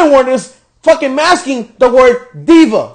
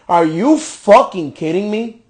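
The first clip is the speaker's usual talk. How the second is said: normal talking voice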